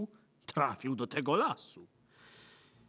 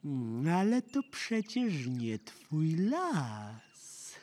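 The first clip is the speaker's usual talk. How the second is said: very slow, drawn-out higher lilt; sly wolfish objection; 4 semitones higher, much slower than usual. Acted. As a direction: stretch it out with feigned puzzlement. Wolf slyly questions the girl.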